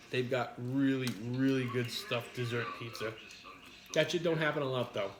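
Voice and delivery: ordinary talk, nothing marked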